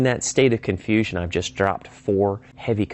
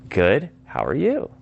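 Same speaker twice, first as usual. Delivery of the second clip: "Good, how are you" slow, swinging up high on "you"; rising reply; 4 semitones higher, slower than usual. Shown as a demonstration. Prospect answers the caller and asks back, rising tone. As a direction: bounce the question back with a strong final rise.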